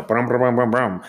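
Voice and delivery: brum out a revving engine; imitated truck engine. Storyteller mimics the maintenance Silverado arriving, revving engine noise. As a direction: vroom like a maintenance truck pulling up. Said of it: "Bob in the maintenance Silverado".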